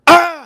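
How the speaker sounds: sudden high, loud yelp; piercing comic "ah!"; mock scream. Streamer acting out a first-date scene, high mock scream. Acts out the date's outburst.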